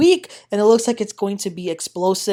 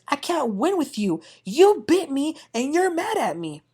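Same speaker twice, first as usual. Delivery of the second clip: higher, put-on whiny voice for Larsa; mocking Larsa's whine; 7 semitones higher than usual. Recapper imitating Larsa complaining to Gertie, whiny. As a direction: play the wounded party, lightly mocked. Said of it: "Larsa says".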